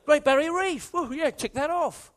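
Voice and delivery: ticking off a bucket list with glee; mock eagerness.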